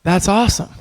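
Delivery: excited awesome. Retold or imitated. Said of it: "And I was like".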